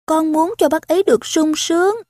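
put-on high-pitched child's voice; earnest little girl. A narrator's reading. Daughter telling her father she wants him happy, high child voice.

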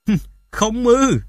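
slow, high, mocking echo; sarcastic. A narrator's reading. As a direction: draw it out with scorn. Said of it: "cái giọng điệu mỉa mai". (giọng mỉa mai)

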